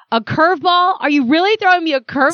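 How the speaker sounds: hammed-up outrage at life; indignant disbelief. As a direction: ask it in exasperated disbelief. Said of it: "I just felt like".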